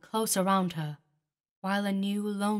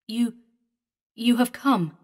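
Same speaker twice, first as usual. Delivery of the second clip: halting words; 4 semitones higher, 6 dB louder than usual. A narrator's reading. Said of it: "he uttered halting words".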